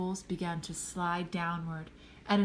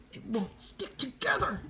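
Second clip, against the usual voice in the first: high, strained mock yell; strained high complaint; yelled in frustration; 6 semitones higher than usual. A narrator's reading. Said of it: "he yelled".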